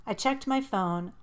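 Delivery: ordinary delivery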